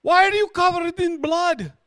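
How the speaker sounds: very high, loud frantic voice; cabbie going bonkers. Acted. Cabbie shocked at his bloodied passenger, going bonkers. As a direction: shriek it like a hammed-up panicked cabbie. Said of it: "going bonkers about his cab".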